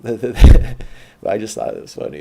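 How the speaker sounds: laughing loudly through the words; real laugh at the memory. Real, not acted. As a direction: crack up while telling it. Laughs remembering the scene.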